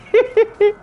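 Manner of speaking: sudden high-pitched laugh. Real, not acted. Streamer cracks up at the chat in a high laugh.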